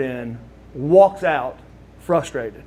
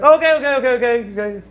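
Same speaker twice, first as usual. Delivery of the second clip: urgent okay; 7 semitones higher, 4 dB louder, faster than usual. Retold or imitated. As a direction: break it up fast, then settle. Sting stopping the fight, retold.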